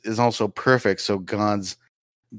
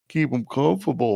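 lightly put-on character voice, raised pitch; keep 'em comfortable voice; 4 semitones higher than usual. Naming the running bit, in its put-on higher voice.

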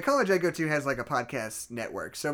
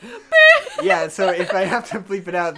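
bursting into laughter; laughing; 6 semitones higher, 12 dB louder, slower than usual. Real, not acted. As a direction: laugh through the words. Cracking up mid-sentence.

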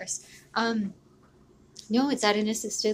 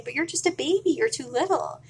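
put-on high cooing baby talk; 5 semitones higher, 4 dB louder than usual. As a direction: coo it like to a toddler. Talking to the bear as baby.

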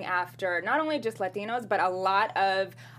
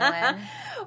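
high laugh; 13 semitones higher than usual. Real, not acted. Laughs at the jab.

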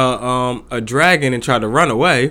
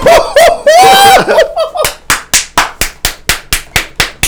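shrieking high laugh; loud laughter; 6 dB louder than usual. Real, not acted. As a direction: burst out laughing loudly. Wild laughter at co-host.

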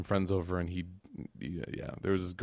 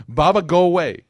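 put-on loud, slightly raised little-kid protest; lightly voiced child; 6 semitones higher, 10 dB louder than usual. Toddler to his father before getting in trouble, mock protest. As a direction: imitate a small child shooing dad off. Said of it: "When you know what he says".

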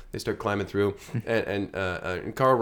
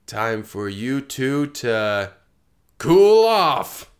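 villain line; 6 dB louder, much slower than usual. Acted. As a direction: drag it out, then blast the last words. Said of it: "he's like".

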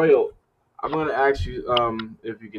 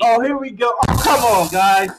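loud exasperated groan; 13 semitones higher, 8 dB louder than usual. Real, not acted. Cohost groans at teasing.

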